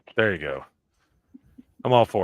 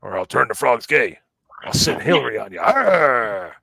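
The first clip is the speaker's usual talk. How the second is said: raised, loud rant ending in a growled roar; Alex Jones; 4 semitones higher, 7 dB louder than usual. Acted. Host showing off an impression to chat, mock-ranting. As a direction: rant louder and higher, then let out a big "Arr!". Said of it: "my Alex Jones impression".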